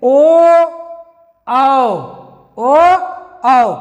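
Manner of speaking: ओ and औ said alone, alternating slowly; contrasting vowels. Shown as a demonstration. Teacher contrasting two vowels for class. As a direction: set ओ against औ, clearly apart. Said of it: "तो देखिए".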